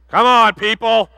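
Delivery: slow, high, loud appeal to the crowd; playful exasperated plea; loud urging. Real, not acted. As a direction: drag it out: come on, people. Pastor urges congregation to get it.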